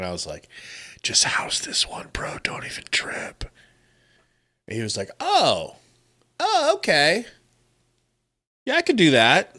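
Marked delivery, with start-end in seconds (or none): 1.0-3.4 acted sideline whisper in the ear. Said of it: "I whispered in his ear". 5.2-9.6 higher, acted pleasant surprise; dawning agreement. Acting teammate's reply to whisper.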